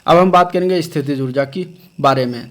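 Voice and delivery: normal talking voice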